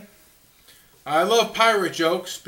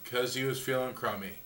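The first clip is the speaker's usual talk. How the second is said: lower, much softer deadpan echo; deadpan repeat; 7 semitones lower, 11 dB quieter than usual. Shown as a demonstration. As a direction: repeat it dry and soft.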